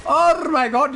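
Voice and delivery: normal talking voice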